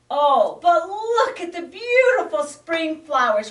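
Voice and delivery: high, loud, gushing voice; delighted cry. Acted. Frog pleads with the bear, delighted cry. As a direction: gush about the flowers. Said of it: "cried the peeper".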